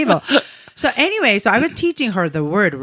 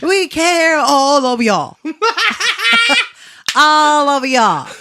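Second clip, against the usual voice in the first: put-on southern accent; 6 semitones higher, 4 dB louder than usual. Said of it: "do a southern accent".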